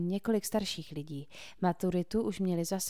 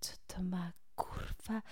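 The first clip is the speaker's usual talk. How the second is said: hushed curse; 8 dB quieter, slower than usual. A narrator's reading. Janet startled, swears under breath.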